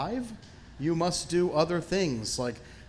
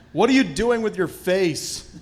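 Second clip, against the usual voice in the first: imitated director's yell, loud and high; retold vehement yelling; 6 semitones higher, 9 dB louder than usual. Director to actor on a close-up, retold vehement yelling. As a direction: yell it as the exasperated director did. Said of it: "vehemently yelling at me".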